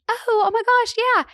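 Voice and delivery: high-pitched re-enacted "it just clicked"; sudden recognition. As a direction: act out a delighted realization. Acts out her sudden recognition.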